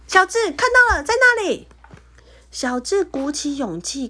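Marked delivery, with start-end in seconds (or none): 0.1-1.7 高声响亮地喊; 激动地大叫提醒. Acted. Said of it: "他大喊". (大声喊叫)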